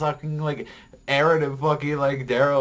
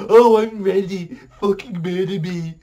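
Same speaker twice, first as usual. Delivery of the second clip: mocking Gabriel; 8 semitones higher, 8 dB louder than usual. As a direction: ham up a priest begging to be killed. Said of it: "fucking Gabriel, he's like".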